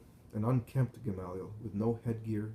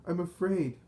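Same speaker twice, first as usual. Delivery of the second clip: high, louder frightened cry; moaning in fear; 7 semitones higher, 5 dB louder than usual. A narrator's reading. Collapsed Pharisee moaning on the ground in fear. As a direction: wail it out, pitch well raised.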